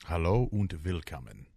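German. Acted. Co-host greets listeners in German, mild German accent. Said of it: "It's very Germanic".

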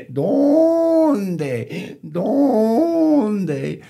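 loud, high braying stretched over the word; donkey bray. Acted. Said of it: "the donkey brayed".

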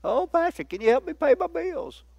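high, soft imitated whine; hammed-up pleading to the pastor; mock whining. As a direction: whine like a needy church member. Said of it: "don't come whining to me".

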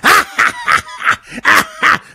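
high, exaggerated mocking cackle; hammed-up mocking laugh; mock cackling laugh. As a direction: imitate her laugh as a shrill joke. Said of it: "With that laugh".

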